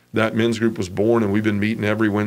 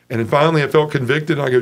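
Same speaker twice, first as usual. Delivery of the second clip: ordinary talk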